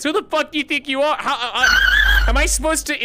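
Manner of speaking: high exasperated ranting, laughing at the end; hammed-up fury at a bungled line; mock outrage. Real, not acted. Streamer hamming up outrage at a friend who flubbed an insult, mock fury.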